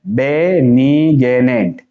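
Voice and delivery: very slow, stretched "बेनिग्नेंट"; syllable by syllable. Shown as a demonstration. Teacher showing students how to read it, slowly in syllables. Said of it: "ये क्या है".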